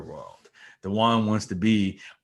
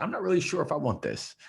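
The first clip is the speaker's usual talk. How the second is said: unsure voice; 8 semitones higher, faster than usual. Retold or imitated. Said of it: "telling the universe that".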